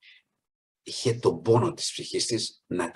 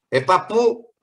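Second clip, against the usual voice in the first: high, loud careless "hey grandpa"; imitating a disrespectful shout at an old man; mock rude call; 11 semitones higher, 6 dB louder, slower than usual. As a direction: toss it out rudely, pitch way up.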